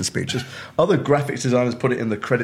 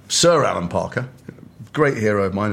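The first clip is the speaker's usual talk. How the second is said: ordinary delivery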